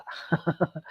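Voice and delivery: short voiceless chuckle; amused chuckle. Real, not acted. Speaker recalling they didn't believe, amused chuckle. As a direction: chuckle softly to yourself.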